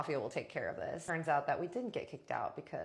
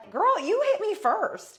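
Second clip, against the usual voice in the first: loud, very high comeback; acted-out shrieked retort; indignant yell; 9 dB louder than usual. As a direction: shout back in offended disbelief. Retorts in retold fight.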